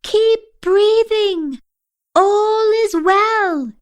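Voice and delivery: high, slow, drawn-out call; motherly calling. A narrator's reading. Asha floating away calls like a mother, reassuringly. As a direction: sing it out slowly from afar. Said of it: "like a mother rather than a ten-year-old".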